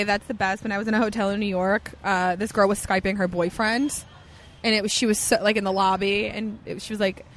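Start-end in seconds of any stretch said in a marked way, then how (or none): none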